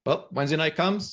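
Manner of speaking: usual speaking voice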